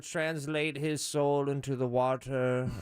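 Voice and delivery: mock-pretentious thespian; self-important actor send-up; weird actor. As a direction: parody an artsy actor, slowing into the final words. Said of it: "actors are weird".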